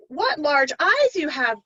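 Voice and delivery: put-on high, slow amazed little girl; wondering child. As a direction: marvel at the strange eyes. Girl marvels at granny.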